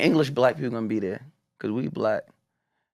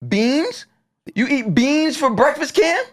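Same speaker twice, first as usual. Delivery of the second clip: much higher, disbelieving questions; incredulous disbelief; slower than usual. Real, not acted. Host asking his friend Cam about beans, high disbelief. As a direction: sound amazed that anyone eats that.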